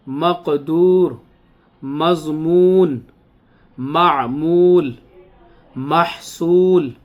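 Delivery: words drawn out with a clear final ha; slow dictation reading. Acted. Urdu teacher reading the word list to class. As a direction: read each word slowly for pupils to repeat.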